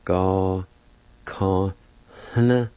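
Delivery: each syllable alone, with pauses between; slow pronunciation demo with a nasal "hna". Shown as a demonstration. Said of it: "It's pronounced like this".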